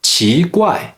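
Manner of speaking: 奇怪 with 奇 rising, 怪 falling from high; second then fourth tone. Shown as a demonstration. Teacher demonstrating mixed tones, 奇怪 rising then falling. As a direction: lift 奇, then drop 怪. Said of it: "Second tone, then fourth tone".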